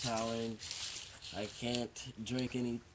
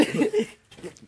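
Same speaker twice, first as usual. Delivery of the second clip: high burst of real laughter; 13 dB louder than usual. Real, not acted. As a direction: break into giggles. Laughs after cinnamon attempt.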